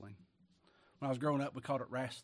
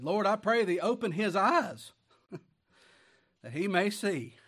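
fervent prayer; 7 semitones higher, 9 dB louder, slower than usual. Acted. Elisha praying aloud.

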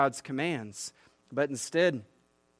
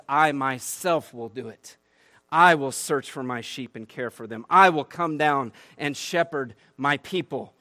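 firm scripture quote; 6 dB louder than usual. Acted. Pastor quotes God to the congregation, firm and solemn. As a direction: speak it as God's solemn promise.

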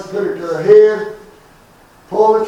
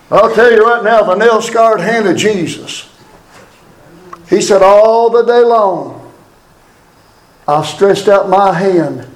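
impassioned street sermon, rising and falling; preaching. Acted.

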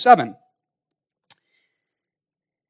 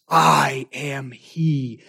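very slow, deliberate words; solemn declaration; 3 semitones lower, 6 dB quieter, much slower than usual. Acted. Jesus naming himself to the soldiers, very slow and solemn. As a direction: let each word stand alone. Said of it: "now stands".

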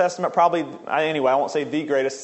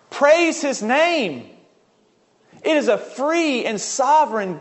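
slow, higher-pitched preaching with drawn-out key words; fervent preaching; 4 semitones higher than usual. Real, not acted. Preacher urging listeners to value the gift of faith, fervent. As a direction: preach it with fervor, stretching the phrase endings.

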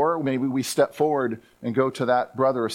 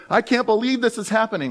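higher, louder, dismayed disbelief; mock disbelief; 8 semitones higher, 4 dB louder than usual. Preacher imitating believers shocked by persecution, mock dismay. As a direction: gasp it out in hammed-up shock. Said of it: "we go".

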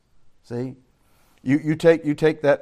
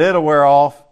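loud scoffing; 10 dB louder, slower than usual. Retold or imitated. Brother Dan dismissing the conversion.

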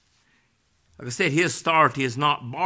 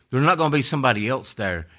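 ordinary talk, nothing marked

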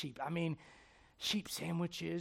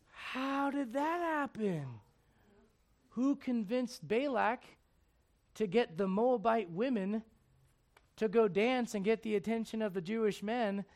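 higher, slow drawn-out wondering; slow puzzled question; 5 semitones higher, 5 dB louder, slower than usual. Retold or imitated. Preacher voicing the congregation, slow puzzled question. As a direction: ask it like a bewildered listener. Asking on listener's behalf, slowed.

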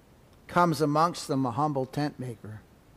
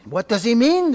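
higher, louder mocking question; scoffing question; 7 semitones higher, 7 dB louder than usual. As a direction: sneer at the idea. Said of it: "they ask themselves".

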